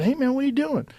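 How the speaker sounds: light up at seeing him; excited greeting. Retold or imitated.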